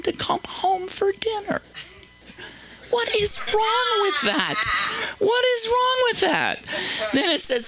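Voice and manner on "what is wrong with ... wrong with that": indignant shout. Real, not acted. Comic shouting in mock outrage at the audience. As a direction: cry out what's wrong with that. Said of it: "indignant outburst".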